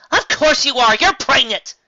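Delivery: high, loud shout. A narrator's reading. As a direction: Twilight shouts back at Cadance. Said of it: "Twilight shouted".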